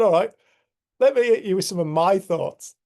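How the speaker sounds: bold challenge. Retold or imitated. Daring to share his thoughts.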